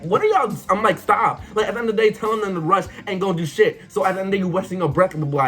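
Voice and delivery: high-pitched exasperated rant; fed up. Retold or imitated. Fed up with choir members correcting everyone, high exasperated rant. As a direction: re-enact going off, words tumbling out high. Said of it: "I get so fed up".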